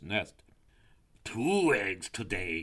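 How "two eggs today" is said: slow, savouring snake voice; greedy snake. A narrator's reading. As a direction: draw it out hungrily. Greedy snake spots eggs.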